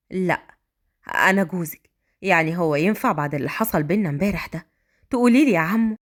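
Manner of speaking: put-on a grown man talking kindly to a child; gentle man voice. A narrator's reading. Raad teases.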